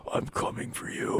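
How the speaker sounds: menacing whisper; whispered Freddy threat. Retold or imitated. Freddy Krueger whispering to his victim, menacing whisper. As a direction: breathe it like a horror villain. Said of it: "and he's going".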